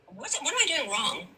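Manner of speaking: ordinary talk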